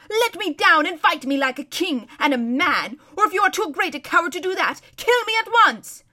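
loud, high howl of challenge; indignant howl. A narrator's reading. Humiliated prince challenging his captor to fight, howling. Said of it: "howled Rapidash".

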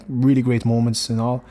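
ordinary delivery

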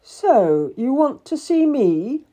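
loud, rising, imperious question; Edward Seymour's greeting. A narrator's reading. Rich man looming over a waiting petitioner, imperious. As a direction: lordly challenge to an unexpected caller.

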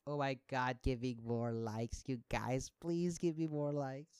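slow, soft whiny plea for likes; mock needy poster. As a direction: beg for likes like a vain influencer. Mocking attention-seeking poster.

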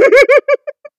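loud, high-pitched giggle fit; burst of laughter. Real, not acted. Friend amused by her own quiz answer, high laugh.

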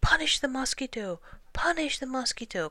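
high, loud chanted demand; urgent repeated outcry; crowd's cry. A narrator's reading. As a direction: cry out as a mob of animals. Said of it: "cried all the animals".

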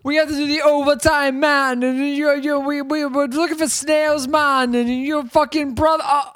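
high, hammed-up angry character voice; mock pissed-off rant. Podcaster imitating man pissed about overtime, mock angry rant. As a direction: rant on without a breath, imitating anger. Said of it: "he's so pissed".

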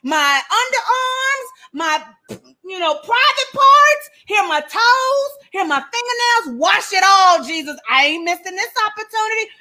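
high, eager Peter pleading to be washed all over; hammed-up eager Peter, voiced high. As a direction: beg Jesus to wash every bit of you.

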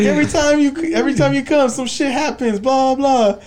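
high annoyed complaint. Retold or imitated. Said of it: "He's like".

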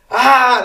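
high, loud exasperated scream; acted-out scream of being fed up; frustrated scream. Teen with entire family against them, frustrated scream.

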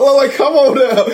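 high, loud come on now; incredulous protest, voice jumping way up; exasperated. Real, not acted. Friend protesting the Nets' trades, exasperated and high.

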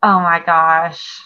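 drawn-out, dismayed; slow, stunned oh my gosh. Real, not acted. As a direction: stretch it out in disbelief. Dismayed exclamation.

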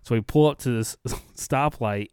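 ordinary talk, nothing marked

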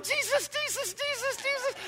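high squeaky terrified mock whimpering; panicked squeal. A preacher retells his own haunted-house fright, high panicked squeal. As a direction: squeal the name in mock terror. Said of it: "It started going".

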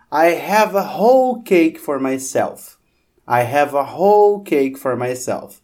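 slow, careful "whole" with h sound; example sentence, slow. Shown as a demonstration. Teacher reading an example sentence slowly, "whole" said with h. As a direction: spell out "whole" as hole, slowly. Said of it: "por exemplo".

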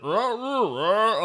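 high, loud wordless roars; garbled roaring in imitation of a movie character; mock unintelligible yelling. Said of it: "What is that dude saying?".

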